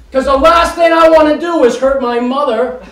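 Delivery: normal talking voice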